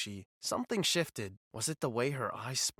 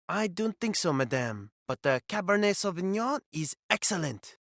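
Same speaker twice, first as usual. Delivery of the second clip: higher mock French waiter; French accent; 5 semitones higher than usual. Fake waiter pushing the Cabernet in French accent. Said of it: "I maintained the act".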